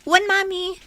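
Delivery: loud answering call. Acted. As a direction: answer mother from another room. Calling back from the kitchen.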